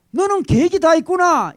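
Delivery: high, loud movie-line admiration; admiring exclamation. Retold or imitated. Father marvels at his son, high admiring exclamation. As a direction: marvel at the son's cleverness. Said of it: "감탄을 하죠".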